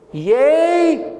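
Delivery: high, drawn-out yea; a sudden stunned realisation; old man catching himself; startled yea. Acted. Isaac realises blessing is given.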